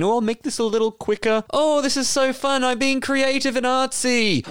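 high, sing-song mockery of a creative type; mocked arty editor. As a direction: mimic your co-host playing happily at editing. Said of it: "You get to play around with your little editing".

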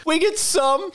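high, loud mock-paranoid protest; hammed-up paranoid squeal. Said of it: "You got paranoid after the comment".

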